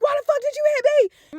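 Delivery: very high, outraged mock whine; imitating angry cousin. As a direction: play the wronged kid, shrill with outrage. Cousin angry after punch.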